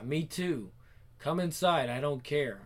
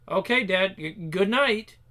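nervous goodbye; 4 semitones higher, 5 dB louder, slower than usual. Acted. Nervous exit from father.

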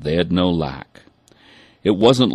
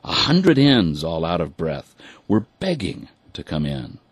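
ordinary delivery, nothing marked

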